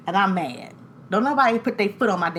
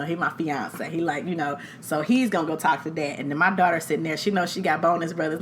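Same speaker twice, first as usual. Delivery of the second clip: ordinary talk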